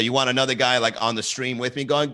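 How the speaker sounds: ordinary talk, nothing marked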